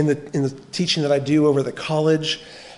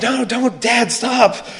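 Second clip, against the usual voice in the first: higher pleading kids' voice, imitated; kids begging Dad; 9 semitones higher, 5 dB louder, much slower than usual. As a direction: play his children begging him to stop. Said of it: "They say".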